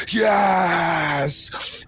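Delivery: long, drawn-out "yes" shout; stretched cry of wild triumph; exultant sailor's yell. A narrator's reading. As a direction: roar it into the storm. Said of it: "as he goes".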